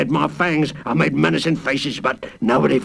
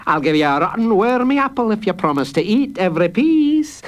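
nasty guard; 7 semitones higher than usual. A narrator's reading. Guard taunting hungry girl, sneering. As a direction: tease with rotten apple. Master Fish voice.

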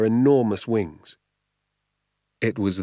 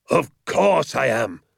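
slow, loud, higher insistence; crying out; 5 semitones higher, 4 dB louder, slower than usual. A narrator's reading. Hazel insists to the sergeant, loud and slow. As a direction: hammer it home. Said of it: "cried Mister Hazel".

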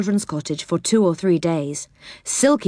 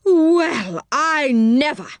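higher, outraged "Well, I never!"; indignant cry; 7 semitones higher, much slower than usual. Acted. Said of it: "cried Moonface".

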